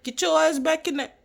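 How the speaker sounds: hammed-up imitation of a harsh staff member yelling; mock angry staff yell. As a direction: tear into the kid like a mean guard.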